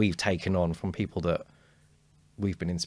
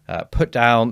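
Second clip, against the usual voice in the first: ordinary talk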